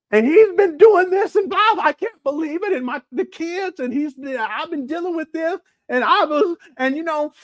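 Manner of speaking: hammed-up upset wife; mocking the wife. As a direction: rant in her high frantic voice. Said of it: "she was like".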